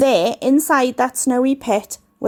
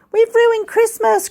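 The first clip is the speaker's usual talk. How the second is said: much higher, dismayed cry; 10 semitones higher than usual. A narrator's reading. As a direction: cry out high and upset. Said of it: "cried out Paul".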